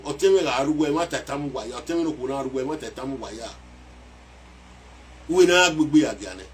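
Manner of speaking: ordinary delivery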